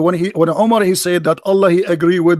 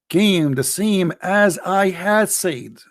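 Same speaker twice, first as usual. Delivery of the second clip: drawn-out, quieter, deliberate reading; slow emphasis; 3 semitones lower, 4 dB quieter, much slower than usual. Real, not acted. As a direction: spell it out word by word. Repeating the verse slowly.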